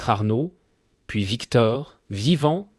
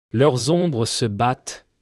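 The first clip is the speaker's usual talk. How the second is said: ordinary delivery, nothing marked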